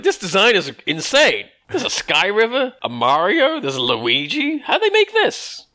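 high, slow incredulous rant; hammed-up high disbelief; mock outrage. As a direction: rant like a nitpicker. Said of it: "It's like".

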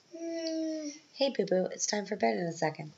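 high, sing-song pet name; real affectionate high-pitched aside. Real, not acted. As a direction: coo a bedtime heads-up to a little one. Breaks off to address little one.

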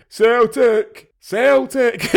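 high, loud chanted shout, mimicking the actor; imitated shouting. Robbie Coltrane shouting back at a Scouser's Liverpool chant, imitated shout. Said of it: "Robbie Coltrane's just shouting".

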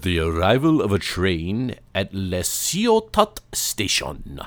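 slow, put-on French accent; mock French, drawn out. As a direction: read the title with a hammy French flourish. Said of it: "So the English translation to that is".

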